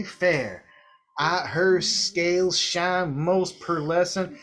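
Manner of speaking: Southern drawl, held through the whole rant. Acted. Clemcletus swooning over Lady Fair.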